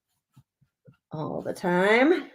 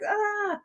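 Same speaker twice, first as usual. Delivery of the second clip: high, breathy mock "Ah" of overwhelm; overwhelmed sigh-cry; 13 semitones higher, 3 dB quieter than usual. Crafter imitates being overwhelmed by choices, high mock gasp. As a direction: throw up your hands vocally. Too many choices exasperation.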